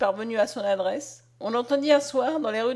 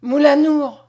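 loud, higher narrated cry of alarm; cry of terror; 4 semitones higher, 10 dB louder, slower than usual. A narrator's reading. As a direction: read it as a startled, fearful shout. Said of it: "pousser un cri de terreur".

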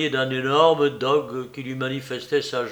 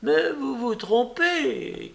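protesting cry; 11 semitones higher, much slower than usual. A narrator's reading. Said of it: "cria la dame".

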